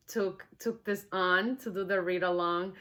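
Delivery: plain reading voice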